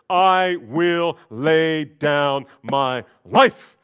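drawn-out swaggering promise; chest-puffing boast. Acted. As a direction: puff up and proclaim it. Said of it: "Puffs up his chest".

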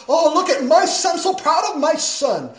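high, loud gushing over my son; mock proud parent. Parent bragging about graduate son, hammed-up pride. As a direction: gush like a proud mom showing off. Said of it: "you're like".

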